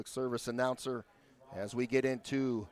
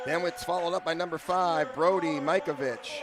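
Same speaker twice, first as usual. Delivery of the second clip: big booming lineup introductions; echoing stadium announcer; 7 semitones higher than usual. Acted. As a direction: announce each name to the arena. Reads home lineup over PA.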